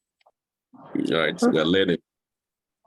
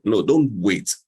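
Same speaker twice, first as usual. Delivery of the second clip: ordinary talk, nothing marked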